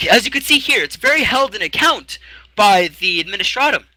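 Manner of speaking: high fussy clerk reading out. Acted. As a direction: play a prim little scribe. Clerk again.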